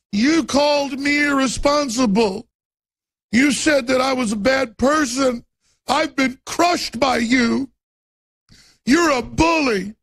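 sarcastic wounded whine rising to a mock yell; mocking whiny victim. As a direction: play the media as sobbing crybabies. Mocking media crying foul.

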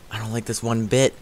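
ordinary delivery

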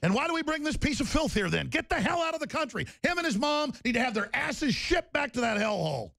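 high, shouted outrage; furious shout. Real, not acted. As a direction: yell them out of the country. Furious rant about killer's father.

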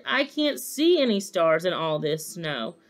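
ordinary delivery

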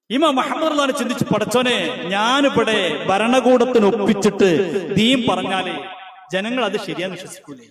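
high, loud preaching at full force; thundering sermon voice; fiery preaching. Acted. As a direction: build to a roaring pulpit climax.

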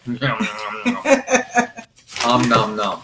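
laughing nom nom while chewing; nom nom chomping. Pretend. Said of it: "That's what I'll be saying".

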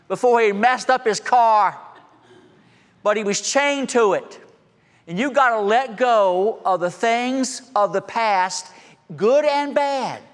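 fervent slow sermon climax; preaching at full force. Acted. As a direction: drive the lesson home, stretching each phrase. Preacher applies the story forcefully.

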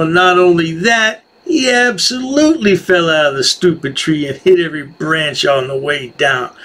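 ordinary delivery

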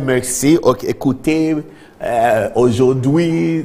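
slow, drawn-out mock French address; lightly hammed-up presidential French; imitating the president's French. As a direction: put on the president's careful French. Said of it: "He said".

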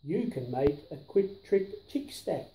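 ordinary delivery, nothing marked

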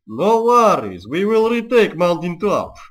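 loud, high, hammed-up Serbian accent; thick Serbian accent. Shown as a demonstration. Gamer vowing to retake the mountaintop, in thick Serbian accent. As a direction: lay the Serbian accent on as heavily as possible. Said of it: "in as much of a Serbian accent as I can".

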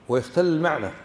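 ordinary delivery

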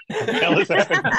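high, laughing disbelief; amused high echo of the line. Retold or imitated. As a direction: repeat it cracking up. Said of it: "and she's like".

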